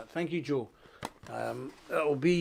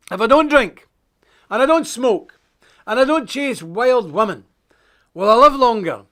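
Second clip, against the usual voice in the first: put-on wee boy voice; 6 semitones higher, 9 dB louder than usual. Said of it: "a wee boy went up to his minister and said".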